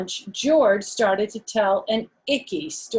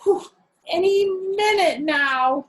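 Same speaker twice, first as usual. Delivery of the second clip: nervous; 11 semitones higher, 5 dB louder than usual. Acted. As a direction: dread the moment, drawing it out. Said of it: "more and more nervous".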